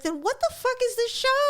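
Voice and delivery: high, incredulous yelp; exasperated outburst. Retold or imitated. Host ranting at a matchmaking show, high exasperated yelp. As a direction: throw the question up in disbelief.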